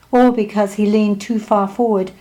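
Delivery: ordinary delivery, nothing marked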